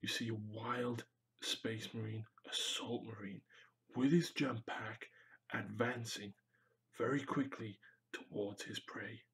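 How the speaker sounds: quiet nature-show presenter, hushed; Steve Irwin voice. Pretend. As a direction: narrate it like a wildlife host. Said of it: "drop into Steve Irwin as well and just go".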